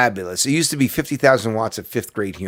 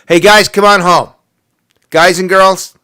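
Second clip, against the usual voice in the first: loud, higher rallying call; 6 semitones higher, 9 dB louder than usual. Acted. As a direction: holler it as if summoning a whole group. Acting out calling troops home.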